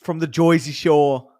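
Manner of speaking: slow Jersey accent, Joyzy Shore with oy. Shown as a demonstration. Streamer jokes about Jersey Shore in a mock Jersey accent. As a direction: lean into a hammed-up Jersey accent. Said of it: "how to do the Jersey accent".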